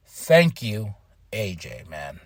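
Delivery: soft, fading, choked farewell; sad and quiet. Real, not acted. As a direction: let it fade out in sorrow. Tribute to retiring wrestler.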